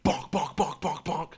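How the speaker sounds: sharp, even, repeated knocking sounds; imitated door pounding. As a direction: pound out each word like a fist on a door. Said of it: "there's a loud pounding at my door".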